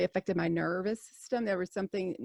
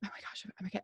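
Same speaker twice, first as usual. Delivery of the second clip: panicked whisper; 2 semitones higher, 6 dB quieter than usual. Retold or imitated. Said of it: "I just remember thinking".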